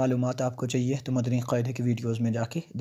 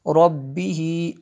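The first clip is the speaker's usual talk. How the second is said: slow, higher recitation of रब्बिही; Quran recitation; 3 semitones higher, 4 dB louder, much slower than usual. Shown as a demonstration. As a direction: recite रब्बिही slowly with stretched vowels. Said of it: "teacher reciting word". (धीमी तिलावत)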